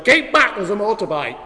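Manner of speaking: high, loud mock warning; mum's warning shout. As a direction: imitate a mum yelling at her child. Mum warning her small child.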